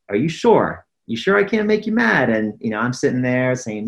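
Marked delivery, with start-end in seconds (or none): 0.1-2.3 retold dad's challenge, pitched up at the end; teasing challenge. Dad challenging his nine-year-old son, teasing. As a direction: lightly needle a cocky kid.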